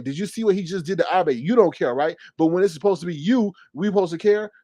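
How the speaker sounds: normal talking voice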